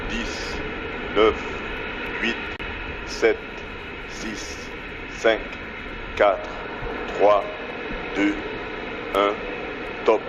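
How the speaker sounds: countdown over a radio loop; mission control radio. Real, not acted. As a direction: read it like a launch controller on comms.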